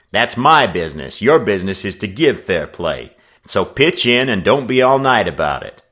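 loud, emphatic workman's orders; forceful, no-nonsense declaration; tart, commanding. A narrator's reading. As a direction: take charge as self-appointed referee. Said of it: "the newcomer retorted tartly".

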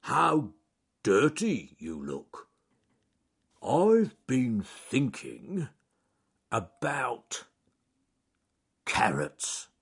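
very slow, halting bear drawl; Charles Growly Bear. Acted. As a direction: ponder aloud with long gaps. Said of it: "he said".

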